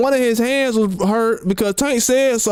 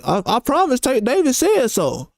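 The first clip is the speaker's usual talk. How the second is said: normal talking voice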